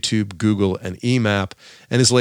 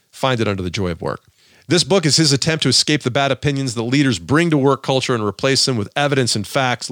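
ordinary delivery

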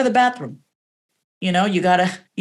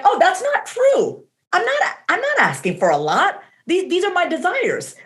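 surprised realization; 8 semitones higher than usual. Retold or imitated. Coach to herself realizing the old belief is false, high surprise. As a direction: lift the pitch as the truth lands.

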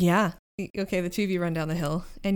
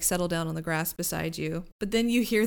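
ordinary talk

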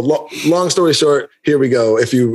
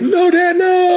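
high, drawn-out cry of protest; mock alarmed plea; much slower than usual. Son begging dad not to fight online, high mock alarm. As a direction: wail it in an exaggerated high voice.

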